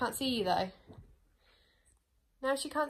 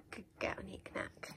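whisper; 3 semitones lower, 14 dB quieter than usual. Real, not acted. Soothing the dog.

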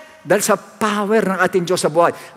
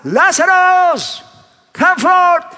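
slow, drawn-out call, higher and louder; shouted command; 7 semitones higher, 4 dB louder, much slower than usual. Retold or imitated. Preacher acting Jesus calling Lazarus from the tomb, booming shout. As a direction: call the dead man out of the tomb. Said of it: "Isang sigaw lang".

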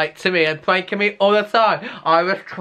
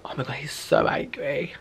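whisper; 5 semitones lower, 9 dB quieter than usual. Real, not acted. Hiding from boyfriend, aside to camera.

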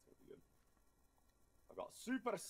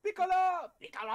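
calling out; 13 dB louder, faster than usual. Acted. Player shouting the character's name across the map. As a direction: stretch the name into a loud high call.

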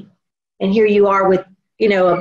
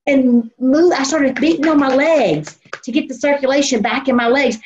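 ordinary delivery

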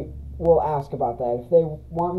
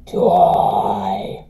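evil low growl; 10 semitones lower than usual. Acted. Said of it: "that flowery voice".

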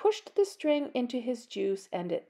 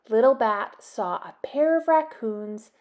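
ordinary delivery

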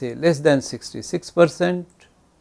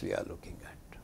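whispered aside; 23 dB quieter than usual. Real, not acted. Lecturer aside under breath.

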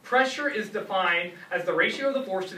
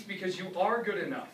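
ordinary talk, nothing marked

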